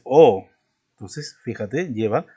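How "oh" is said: a sudden, loud surprised "Oh". Shown as a demonstration. Teacher acting out how you say "Oh" when surprised. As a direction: burst out with "Oh" as if caught off guard. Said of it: "cuando te sorprendes dices".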